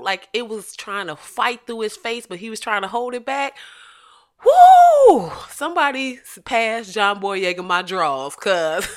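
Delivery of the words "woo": very high, loud, drawn-out "woo"; thrilled whoop. Real, not acted. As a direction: whoop loudly, swept away. Whoop over the actor's intensity.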